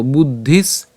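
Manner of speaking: "বুদ্ধিস" slowly as bud-dhis; careful Sanskrit pronunciation. Shown as a demonstration. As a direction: hold the double consonant in "বুদ্ধিস", end on s. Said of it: "ধিস পর্যন্ত উচ্চারণ করছি". ("বুদ্ধিস" ধীরে, শেষে স সহ)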